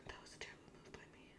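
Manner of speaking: fast, barely audible whisper; whispered self-reproach. Real, not acted. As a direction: whisper it to yourself quickly. Muttering to self over move.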